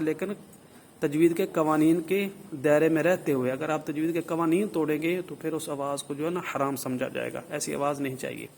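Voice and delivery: ordinary talk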